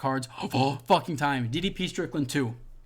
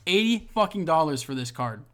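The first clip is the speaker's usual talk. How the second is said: ordinary talk, nothing marked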